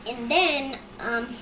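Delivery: usual speaking voice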